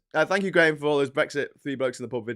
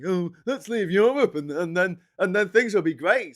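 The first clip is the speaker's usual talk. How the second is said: high, silly imitation of a Brexiteer; mock Brexiteer; 4 semitones higher, 4 dB louder than usual. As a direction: play the gullible leaver, higher and brighter. Mocking Brexiteers' naive reasoning.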